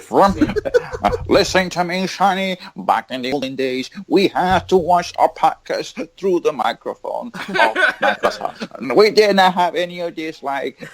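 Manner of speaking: high, slow, hammed-up old geezer; old-timer scolding youngsters, playful and high; old man voice. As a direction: lecture the kids like a cranky grandpa. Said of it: "Fucking old man with a beard".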